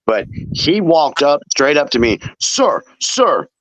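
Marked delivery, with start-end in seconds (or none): 2.4-3.5 higher, mock urgent "sir, sir"; calling worker. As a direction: imitate the store guy calling after him. Imitating the worker calling him.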